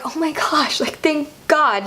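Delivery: high, slowing retold relief. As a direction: let the relief draw the words out. Retold relief at being fine.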